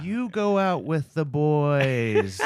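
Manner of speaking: slow, drawn-out nasal impression; Rob. Imitating an acquaintance for co-hosts, nasal voice. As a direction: stretch it out in a put-on nasal voice. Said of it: "Rob would be like".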